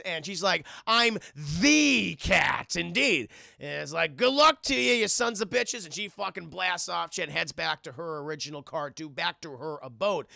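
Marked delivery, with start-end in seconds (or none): 0.9-3.3 slow, drawn-out, grandly voiced cat. 4.2-5.8 mocking farewell.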